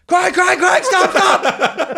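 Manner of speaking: high, loud panicked warning; urgent yell. Retold or imitated. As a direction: yell to halt the digger driver. Retold warning yell in accident.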